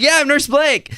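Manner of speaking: high, loud, beaming self-introduction; proud eager answer. Retold or imitated. Nurse volunteering to the flight attendant, high proud answer. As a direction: leap at the chance to be the hero.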